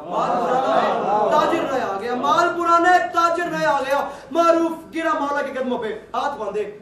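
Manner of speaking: preacher hammers the punchline home, rising to a cry; building to a proclaiming shout. Acted. Preacher repeating the imam's line to the crowd, building into a loud cry.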